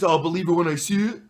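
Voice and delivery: Brooklyn guy with an accent, lightly hammed; mock Brooklyn accent. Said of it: "I have to say it with that accent".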